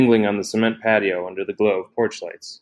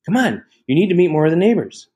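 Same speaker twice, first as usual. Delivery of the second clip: higher, louder and bubbly, pulling her along; chirpy, insistent hostess voice; eager sister's voice; 8 semitones higher, 4 dB louder than usual. A narrator's reading. Sister urging Francine out to meet neighbors, eager and chirpy.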